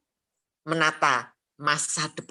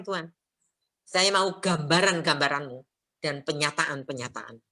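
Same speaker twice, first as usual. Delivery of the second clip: ordinary talk, nothing marked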